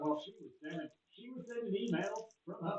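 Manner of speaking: put-on a light generic Southern accent, Clinton style; Bill Clinton voice. Bill Clinton explaining the emails, in a light Southern accent. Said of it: "I'm doing Bill Clinton's voice still".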